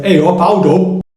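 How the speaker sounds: slow, broad Yorkshire greeting; Yorkshire accent. Shown as a demonstration. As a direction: drawl it out Yorkshire style. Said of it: "People from Yorkshire will say".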